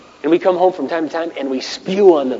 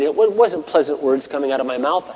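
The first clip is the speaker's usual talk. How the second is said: ordinary delivery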